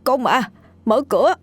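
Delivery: frantic prisoners shrieking for the guard; panicked cries for help. Acted. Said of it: "Chúng hoảng hốt kêu lên".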